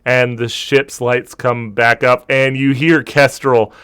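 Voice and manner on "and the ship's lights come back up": ordinary delivery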